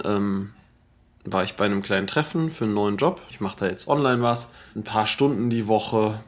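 ordinary delivery

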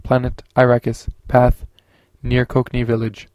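loud, even machine readout; flat system log, loud and mechanical; computer voice. A narrator's reading. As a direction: announce each item like a ship's computer.

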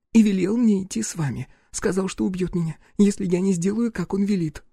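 put-on high-pitched scared girl's voice; frightened young woman. Girl tells her rescuer about the threat, frightened.

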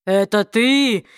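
low, slow, menacing demon voice; a slow low threat from the smoke. Acted. Demon questions the man.

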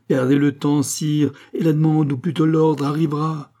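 loud, high, insistent outburst; heated warning. A narrator's reading. Queen warns the king an order will come, heated warning. Queen mocks the king's delay.

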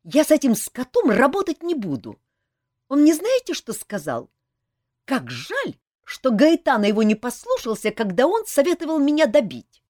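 high, loud, indignant complaint; angry outburst. A narrator's reading. Saeta rejects working with her partner, furious. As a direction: refuse hotly, voice climbing.

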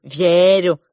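loud, drawn-out teasing echo; mocking mimicry. A narrator's reading. Younger prince mimicking his rival, mocking. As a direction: parrot it back to tease. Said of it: "передразнил его младший принц".

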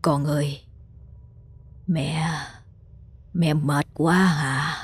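weak, slow, breathless murmur; faint rasping whisper. A narrator's reading. Sick mother murmuring weakly to her daughter, faint whisper. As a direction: barely get the words out, dying. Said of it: "một tiếng thều thào".

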